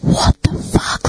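slow breathless whisper; whispered curse. Retold or imitated. Alone at night, finding the blanket on, whispered. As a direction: hiss it to yourself in fear. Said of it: "I'm like".

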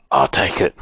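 low hoarse owl voice; low, rasped agreement. A narrator's reading. Barn owl taking Silky's note, hoarse. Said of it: "in a hoarse voice".

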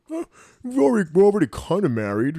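high, loud, hurried stammer; flustered protest. Acted. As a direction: get defensive, pitch jumping up. Flustered answer to marriage question.

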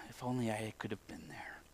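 very low, hushed inner thought; wistful whisper. Acted. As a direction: whisper it like a private longing. Voicing a wistful private thought.

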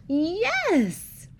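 high, loud imitated child's yell; elated kid's cry, retold at full voice; joyful shout. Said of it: "he shouted".

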